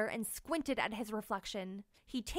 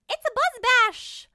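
much higher, louder proud announcement; proud mayfly; 13 semitones higher, 13 dB louder than usual. Acted. Maisie explaining the party to Tank, proud high call. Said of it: "Maisie explained proudly".

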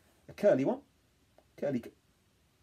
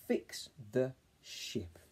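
very slow, drawn out; slow blend; 2 semitones higher, much slower than usual. Shown as a demonstration. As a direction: stretch each word slowly. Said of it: "let's try and read it together".

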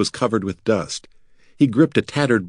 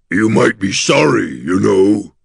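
louder, grave creature warning; grave gargoyle; 5 dB louder, slower than usual. A narrator's reading. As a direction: warn them solemnly in a monster's voice. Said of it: "Gus said gravely".

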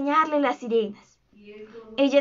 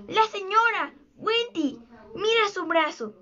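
higher, urgent storybook exclamation; excited cry; 6 semitones higher than usual. A narrator's reading. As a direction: point it out in an excited high voice. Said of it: "gritó".

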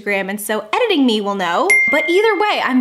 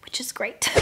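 soft, near-whispered aside; under the breath, barely voiced; hushed aside; 9 dB quieter than usual. Real, not acted. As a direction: drop to a whisper for a quick aside. Aside after saying she can manage it.

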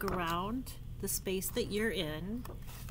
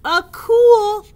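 building elephant wail; 7 semitones higher, 11 dB louder, slower than usual. Acted. Gerald voiced in book.

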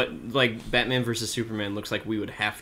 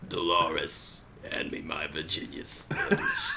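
put-on low, slow old smoker lady; 6 semitones lower, 5 dB quieter, slower than usual. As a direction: rasp it out like an old chain smoker. Mock smoker skit.

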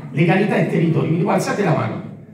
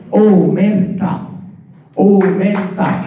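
Omertà stretched slow, each one landed; slow emphatic word; 3 semitones lower, much slower than usual. Acted. Speaker names the students' problem, slow emphatic Omertà. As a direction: drag out Omertà twice, weighty.

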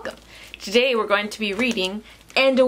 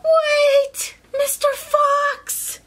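very high, drawn-out little call; bird calling out; 14 semitones higher, much slower than usual. Acted. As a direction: call after someone in a tiny high voice. Bird calls after Fox.

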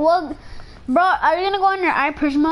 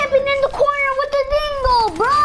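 real exasperated yell; 9 semitones higher, faster than usual. Real, not acted. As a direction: yell at the camper, voice pitched up. Yelling at camping opponent in game.

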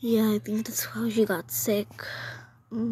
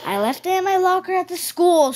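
raised higher, louder voice over a lost backpack; loud scolding; 7 semitones higher, 5 dB louder than usual. Pretend. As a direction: call him out loudly. Mother and child arguing over backpack.

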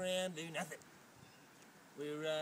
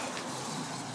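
real laugh. Real, not acted. Laughs after yelling at strangers.

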